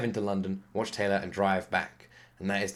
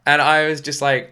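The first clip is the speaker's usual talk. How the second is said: ordinary delivery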